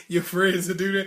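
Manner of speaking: ordinary talk, nothing marked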